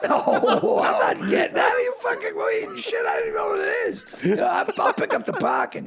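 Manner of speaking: loud, high-pitched comic protest; hammed-up refusal. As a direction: shout down the very idea of paying. Acted refusal to pay the bill.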